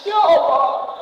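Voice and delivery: high-pitched mock weeping plea; brief high wail; imitated tearful prayer. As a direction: act out crying to God.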